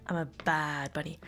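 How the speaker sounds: usual speaking voice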